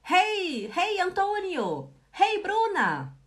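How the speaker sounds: high, loud friendly hey; greeting friends across the room, bright and loud; cheery greeting. Shown as a demonstration. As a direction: call out hey to a passing friend. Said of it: "Eu vejo a Natasha passar e digo".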